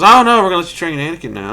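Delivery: high, loud, hurried announcement; mock council pronouncement. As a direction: blurt a sudden change of mind, mockingly. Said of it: "the Jedi Council goes".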